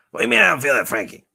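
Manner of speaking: loud, high, fast protest; indignant puppet. Pretend. Puppet objecting to its maker about its name, indignant. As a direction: fire back as an offended toy character.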